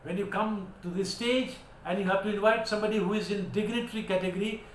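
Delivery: usual speaking voice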